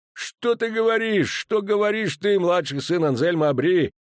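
put-on dwarf commander rages at young officer; furious dwarf. A narrator's reading. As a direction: rage at the insult to his troops. Enraged dwarf berates young officer.

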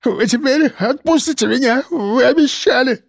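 high, frantic begging in a choking voice; desperate plea to be set free; panicked pleading. A narrator's reading. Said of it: "Он захлёбывался криками".

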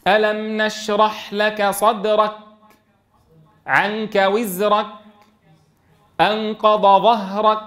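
slow recitation, كاف with no breath after it; unaspirated kaf. Retold or imitated. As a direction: mimic the wrong dull kaf. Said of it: "ينطقون الكاف من غير همس".

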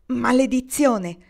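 Maledizione! shouted out slow and loud; exclaimed curse. A narrator's reading. Kendra curses her torn skirt, exclaiming loudly. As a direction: throw out Maledizione! as an exasperated curse. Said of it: "esclamò Kendra".

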